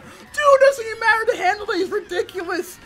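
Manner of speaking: excited. Real, not acted. Excited racing, pitch way up.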